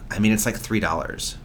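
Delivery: low, sighing imitation of an irritated worker; annoyed. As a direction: brush off a petty complaint with a weary sigh. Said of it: "Just, like, so annoyed".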